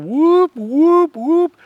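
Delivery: high, loud whooping calls; mimicking a Bigfoot howl. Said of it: "Bigfoot call's just like on T V, real loud".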